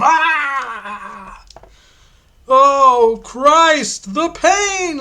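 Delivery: the priest caught by chains, howling; screaming in pain. Acted. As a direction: shriek as the hooks tear in.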